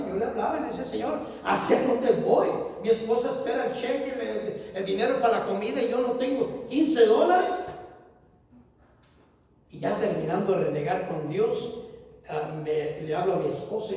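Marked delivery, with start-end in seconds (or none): 0.8-7.6 higher, insistent plea; complaining prayer. Retold or imitated. Preacher retelling his complaint to God, high pleading. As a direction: press God for an answer.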